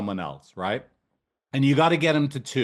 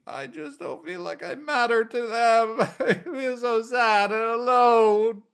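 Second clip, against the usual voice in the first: acted sad client; 11 semitones higher, slower than usual. Shown as a demonstration. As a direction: play the lonely client, voice high and small with a laughing sob. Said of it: "to actually make 'em feel deeply".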